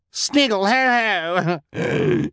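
high diva shriek-laugh. A narrator's reading. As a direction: shriek it out in wicked triumph. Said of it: "Esme shrieked".

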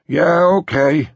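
high, loud grumpy concession. A narrator's reading. Boss conceding security chief's point, grumpy high voice. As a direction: give in grudgingly, high and loud. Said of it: "Philip Evans said grumpily".

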